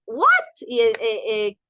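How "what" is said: mock baffled outburst; baffled reaction. Teacher voicing overwhelmed students' confusion, mock baffled. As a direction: act out a student's sudden confusion. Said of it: "puede ser que ahorita sintamos".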